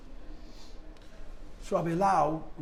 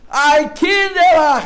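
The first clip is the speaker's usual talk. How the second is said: anguished cry; 9 semitones higher, 10 dB louder, slower than usual. Retold or imitated. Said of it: "He couldn't speak".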